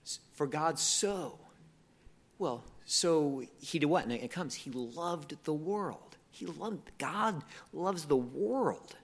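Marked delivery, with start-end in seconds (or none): 0.3-1.4 SO drawn out slowly with a falling tune; slow, weighty dwelling on so; stress on so. Shown as a demonstration. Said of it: "you're kind of emphasizing each word". 4.5-8.9 slow, with weight on LOVED and WORLD; emphasizing each word. Shown as a demonstration. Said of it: "you're kind of emphasizing each word".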